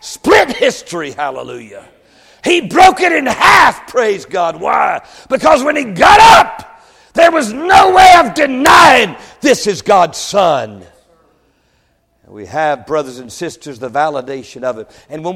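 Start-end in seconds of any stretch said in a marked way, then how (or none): 0.0-10.8 loud, high, triumphant proclamation; full-force preaching. Real, not acted. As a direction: cry it out to the congregation, stretching key words.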